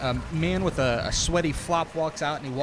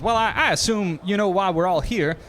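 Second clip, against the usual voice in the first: fake Texas accent; 5 semitones higher, 5 dB louder than usual. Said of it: "his Texas accent, is bad and fake".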